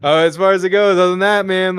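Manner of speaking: high, loud, over-friendly retelling; trying for charismatic. Retold or imitated. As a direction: keep charming them to get by. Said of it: "charismatic as possible".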